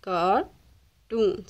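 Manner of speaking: "cartoons" in two parts, car, pause, toons; split into syllables. Shown as a demonstration. Teacher showing how to divide the sounds, split into syllables. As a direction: leave a gap inside "cartoons" after car. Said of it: "इसी तरह आप divide करेंगे sounds".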